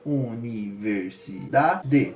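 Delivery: "Universidade" split slowly into its syllables; u-ni-ver-si-da-de said slowly, syllable by syllable. Shown as a demonstration. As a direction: tap out each syllable of "Universidade". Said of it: "seis sílabas".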